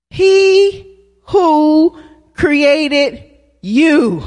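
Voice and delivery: each word drawn out slowly; slow call for the congregation to echo; slow emphatic recital. Shown as a demonstration. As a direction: lay out every word for the church to repeat. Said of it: "Say that with me".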